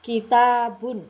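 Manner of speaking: "Kitabun" slow, high and loud, long ta; pronunciation demo. Shown as a demonstration. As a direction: model "Kitabun" syllable by syllable for learners. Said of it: "nah, kita ucapkan".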